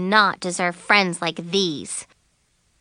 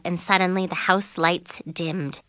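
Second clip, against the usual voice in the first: ordinary delivery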